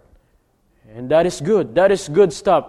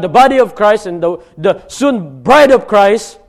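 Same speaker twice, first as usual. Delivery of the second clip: fervent sermon declaration; preaching at full force; 6 semitones higher, 8 dB louder than usual. Acted. Preacher explains the called-out church, fervent. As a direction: proclaim it with conviction.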